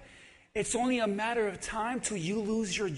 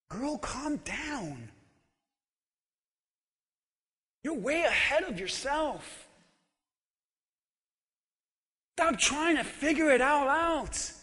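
calming tone; 3 dB quieter than usual. Shown as a demonstration. Preacher gently telling a friend to calm down. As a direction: settle them gently, softly. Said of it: "look at them and say".

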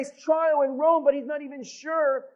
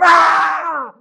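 startled scream; 4 semitones higher, 12 dB louder than usual. Acted. Snake strikes Paul.

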